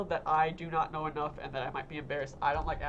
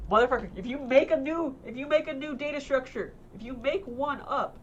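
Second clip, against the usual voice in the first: pissed-off rant; 6 semitones higher than usual. Real, not acted. Student venting at professor about made-up data structures, high furious rant. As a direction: rant at the teacher who invented the assignment. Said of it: "oh my god, I'm pissed off".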